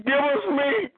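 high, whiny voice of a hungry crowd; whining crowd. Acted. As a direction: whine it like complaining people begging for food. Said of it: "they weep all over me, saying".

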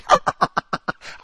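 imitated cackling laugh; mock cackle. Imitating Ashley laughing at her own story, cackling. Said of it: "And she started cackling".

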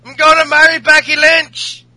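absurd caricature of a shouting Irish brawler; hammed-up Irish accent. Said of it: "I'm Irish".

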